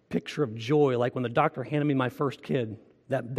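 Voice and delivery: ordinary talk, nothing marked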